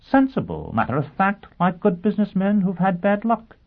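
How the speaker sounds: high-pitched character voice, quick to reply; prompt answer. A narrator's reading. Sheffield answering his would-be client, promptly. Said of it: "Sheffield answered promptly".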